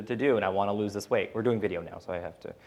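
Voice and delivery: ordinary talk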